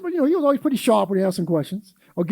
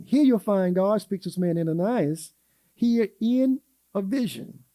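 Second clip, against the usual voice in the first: normal talking voice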